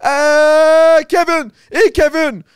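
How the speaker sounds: high hype announcer delivery; hyped host intro. Acted. Host welcoming listeners to the podcast, high hype announcer. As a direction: sell the show like a big-time emcee.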